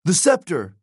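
high-pitched urgent shout; yell. A narrator's reading. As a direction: call out a battle cue. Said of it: "he yelled".

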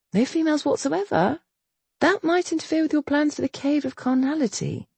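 high, amused teasing voice. A narrator's reading. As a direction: tease him softly. Teasing Alexandra.

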